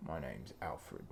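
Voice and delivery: very low, hushed British butler; Michael Caine. Acted. Echoing friend's butler impression, low and hushed. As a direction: sink deep and quiet into the impression. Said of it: "That's how Michael Caine talks".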